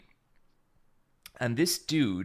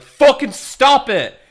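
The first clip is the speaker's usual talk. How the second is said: loud, high yell imitating the friends; angry shout; 12 semitones higher, 11 dB louder, slower than usual. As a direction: shout it to pull a friend off someone. Friends restraining the attacker, acted.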